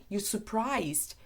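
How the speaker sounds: ordinary talk, nothing marked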